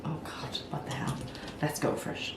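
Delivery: ordinary delivery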